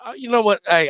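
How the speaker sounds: usual speaking voice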